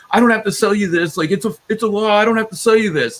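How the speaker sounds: high, loud mocking cashier voice; shrill-free high put-on scold; snippy cashier. Cashier snatches beer, scolding.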